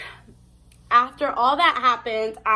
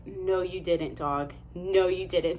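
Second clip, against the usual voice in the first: low, quieter mock disbelief; retold disbelief; 5 semitones lower, 5 dB quieter, slower than usual. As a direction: play stunned disbelief, pitched down.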